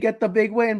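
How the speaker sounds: ordinary talk, nothing marked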